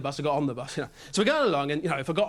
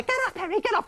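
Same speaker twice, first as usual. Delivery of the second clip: much higher, squealing protest; girlfriend's squeal; 13 semitones higher, faster than usual. Retold or imitated. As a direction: squeal at your boyfriend to stop. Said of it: "He goes".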